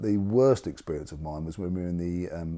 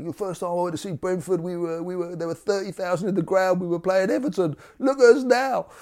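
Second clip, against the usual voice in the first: put-on high, strained old bloke's voice, much higher and louder by the end; old fan's lament; 10 semitones higher, 6 dB louder than usual. Elderly fan next to him mourns the club, high and louder. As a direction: mimic an elderly fan wailing about how far the club has fallen. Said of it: "elderly bloke next to me say".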